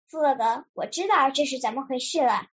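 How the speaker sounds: measured storybook pronouncement, pausing before the verdict; mildly grave, with a long suspenseful pause; serious. A narrator's reading. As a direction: deliver the diagnosis solemnly. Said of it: "严肃地说".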